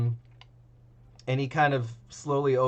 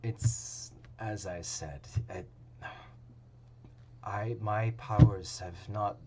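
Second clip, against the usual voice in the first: solemn priest; 6 semitones lower, 8 dB quieter than usual. Acted. Lightly voiced priest tells the party his powers failed, solemnly. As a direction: speak like a priest admitting failure. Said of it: "looks solemnly up at you".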